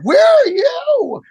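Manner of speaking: high, slow, loud shout back; indignant shout. Retold or imitated. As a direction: throw the question back at them. Retorts to radio staff.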